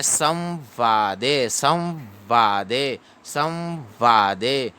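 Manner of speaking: very slow "संवादे" as saw-vaa-de; half-v nasal demonstration. Shown as a demonstration. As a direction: hold the hummed half v in "संवादे". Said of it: "आधा व पढ़ा जाता है".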